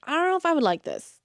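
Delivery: fast, high, hushed doubt; skeptical inner voice. Retold or imitated. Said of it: "reading this, I'm like".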